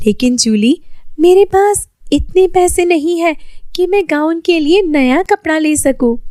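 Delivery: put-on Lily explaining she can't afford cloth, girlish voice; young girl voice. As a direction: voice the poor tailor girl, gently pleading.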